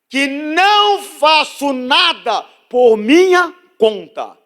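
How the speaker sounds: loud, high, drawn-out preacher's reading; scripture proclaimed. Real, not acted. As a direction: proclaim each phrase at full force, with long pauses. (proclamação bíblica em voz alta e arrastada)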